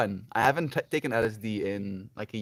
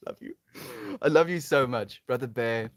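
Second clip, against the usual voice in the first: ordinary talk, nothing marked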